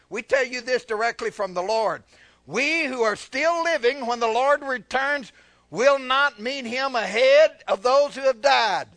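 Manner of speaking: high, loud, emphatic reading; preached scripture reading. Real, not acted. Preacher reading Paul's words to the church, forceful. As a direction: build in force to the last phrase. Reads scripture aloud to congregation.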